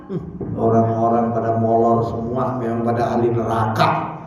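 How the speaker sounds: low, monotone musing to himself; acted private judgement, pitched well down; self-righteous thought. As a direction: look down on the sleepers under your breath. Acted proud worshipper's private thought.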